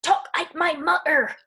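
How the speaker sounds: put-on loud, high, drawn-out little-boy demand; imitating angry child. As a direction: mimic a child's foot-stamping tantrum. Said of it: "He gets so angry".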